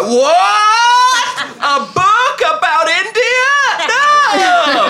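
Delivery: very high, loud mock-ecstatic shriek; slow, squealing hammed-up disbelief; fake overjoyed. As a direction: wildly overact delight at a present. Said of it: "I'm really good at being like".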